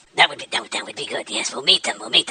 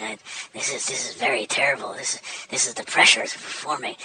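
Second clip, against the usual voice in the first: whispered, hammed-up nerves; mock stage-fright whisper; 5 semitones lower, 7 dB quieter than usual. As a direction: confide the panic under your breath.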